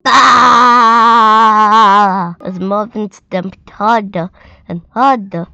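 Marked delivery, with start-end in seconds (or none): 0.1-2.4 long, loud monster roar; big mad roar. A narrator's reading. Marvin stamping his mad feet, long loud roar. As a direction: let rip a huge angry roar. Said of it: "let out the big mad".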